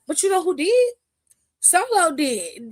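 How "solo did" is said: usual speaking voice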